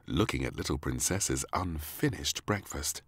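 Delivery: ordinary delivery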